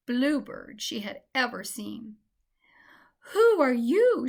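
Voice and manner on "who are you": sobbing. A narrator's reading. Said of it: "she sobbed".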